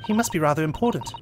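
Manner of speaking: usual speaking voice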